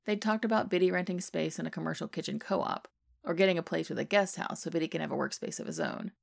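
ordinary delivery

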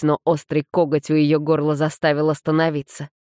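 ordinary delivery

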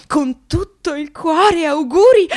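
high, slow, syrupy reading of the text; mock sugary greeting. Reading the agency's birthday text in a sugary voice. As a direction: coo it like a sickly sweet card.

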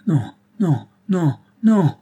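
half scream it in panic; old lieutenant denying his friend is dead, shrill half-scream. Acted. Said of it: "d'une voix glapissante à demi-criarde".

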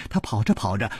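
ordinary delivery, nothing marked

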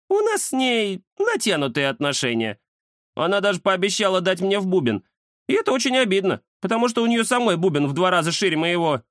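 high-pitched cat character voice; cat Кузя, aggrieved. Acted. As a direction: pipe up high as a put-out cat. (обиженный кот, высокий голос)

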